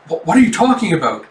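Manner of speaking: high, quick, stammered question; shocked disbelief. Acted. Yona hearing news of the invasion, shocked disbelief. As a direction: blurt it out, taken aback.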